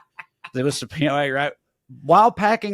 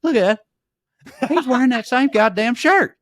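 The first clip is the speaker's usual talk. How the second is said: mock exclaim; 9 semitones higher, 3 dB louder than usual. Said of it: "he said".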